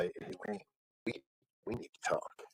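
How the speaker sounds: imitating a producer's grave whisper; ominous hush. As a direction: hush it like bad news is coming. Producer summons him ominously.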